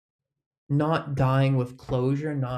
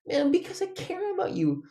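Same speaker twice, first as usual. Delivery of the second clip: mock sappy quote. Mock sappy anime line.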